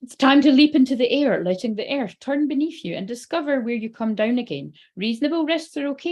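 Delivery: lilting read-aloud encouragement. Real, not acted. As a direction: read it out as rousing guidance. Reads card text aloud.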